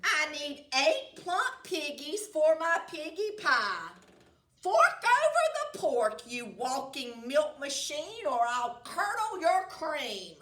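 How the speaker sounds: loud, high furious witch; angry witch threat. Acted. Witch threatening to curdle the cow's cream. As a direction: threaten the cow, shrieking.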